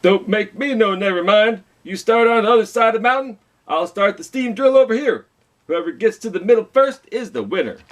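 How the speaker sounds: high, brisk dismissive boss voice. Acted. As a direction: toss it off with a shrug, quick and high. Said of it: "Boss shrugged".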